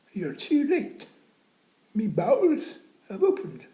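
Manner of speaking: put-on high, loud plaintive old man; old man's lament. Jim telling the nurse she's too late, high and plaintive. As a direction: wail the bad news. Jim's punchline.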